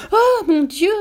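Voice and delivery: high, loud cry of shock; loud, high-pitched gasp of horror; horrified exclamation. A narrator's reading. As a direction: exclaim in sudden fright. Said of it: "s'exclama la mère".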